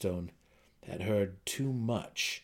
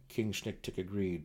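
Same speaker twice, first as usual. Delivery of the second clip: usual speaking voice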